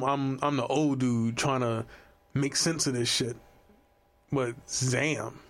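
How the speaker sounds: ordinary talk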